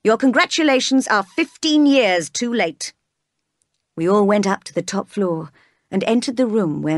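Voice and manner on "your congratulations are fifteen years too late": higher, louder bitter declaration; a loud, bitter rebuke; bitter outcry. A narrator's reading. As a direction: fling the words at them bitterly. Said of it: "cried the master".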